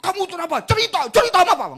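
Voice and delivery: high, loud, fast pressing questions; anxious demanding dad. Retold or imitated. Stressed father pressing his panicking child, loud and high. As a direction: push the child for answers in a panic.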